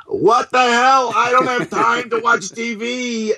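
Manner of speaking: hammed-up confused mom; imitating mom. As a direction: squeak it out like a baffled mother. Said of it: "my mom calling up going".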